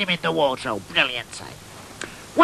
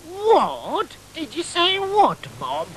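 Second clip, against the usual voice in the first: high, mock-puzzled echo; feigned innocence; 9 semitones higher, much slower than usual. Son pretending not to know about the wood, mock innocent. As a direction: play dumb, drawn out.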